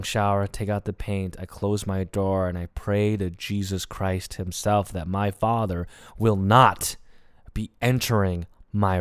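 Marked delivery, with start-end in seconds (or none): none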